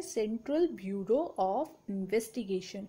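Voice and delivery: very slow, word by word for the class; teacher dictating the full form at a crawl; slow dictation. Shown as a demonstration. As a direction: spell out each word of Central Bureau of Investigation slowly so students can write it. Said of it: "CBI की full form क्या है?".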